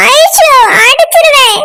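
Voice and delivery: slow, wailing mock threat; tearful threat. Teased girl threatens to hit her friend, wailing. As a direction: whine the threat out through tears.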